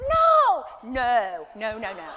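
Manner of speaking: a terrified yelp of no that trails off into pleading; panicked no. Retold or imitated. Facing the fire walk, the speaker relives her panicked refusal.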